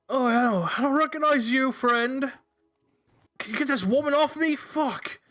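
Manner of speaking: high, strained voice of a man pinned down; pinned npc pleading. Acted. Pinned man pleading with his captors, high and strained. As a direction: beg to be let up, pitched high and tight.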